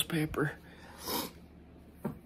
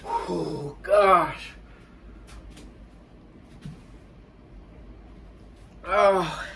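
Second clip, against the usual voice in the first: pained groan; 5 semitones higher, much slower than usual. Real, not acted. Pain from ghost pepper.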